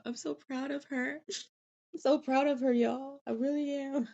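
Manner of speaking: moved to tears by the sister's story; choked-up pride. Real, not acted. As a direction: hold back happy tears. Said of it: "I'm not gonna get emotional".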